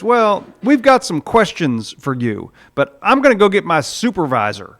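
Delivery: an official passing the buck; flustered angel. Retold or imitated. Gate angel telling the thief he will fetch his supervisor.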